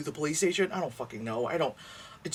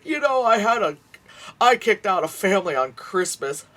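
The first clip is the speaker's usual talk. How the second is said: mock landlord brag; 6 semitones higher, 6 dB louder than usual. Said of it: "that landlord thing of".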